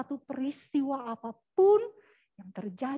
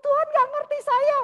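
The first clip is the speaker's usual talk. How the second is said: loud, high, indignant tirade; angry ranting; 14 semitones higher, 8 dB louder than usual. Retold or imitated. Castaway scolding God over his burned hut, angry ranting. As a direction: heap accusations upward, voice raised throughout. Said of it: "dia marah-marah sama Tuhan".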